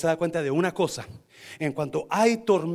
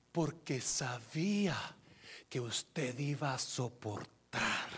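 slow, soft, weighty delivery; quiet, deliberate sermon voice; hushed solemn preaching; 4 semitones lower, 9 dB quieter, much slower than usual. Real, not acted. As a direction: preach it low and slow, letting each phrase land.

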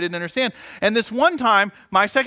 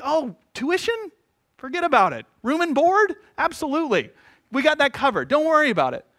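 mock college clerk; 8 semitones higher than usual. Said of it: "they're like".

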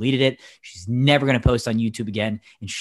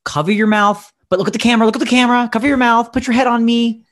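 raised, insistent imitation of the mom directing her kid; higher, pushy stage-mom coaching, half-mocking; mock bossy mom; 10 semitones higher, 4 dB louder, faster than usual. Mom coaching her crying kid for the camera, mock insistent voice. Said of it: "she's like".

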